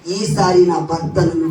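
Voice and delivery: slow and low, longing for her husband; low, slow Leah longing to be held; Leah hoping. Acted. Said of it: "అందుకే ఏమంటుంది?".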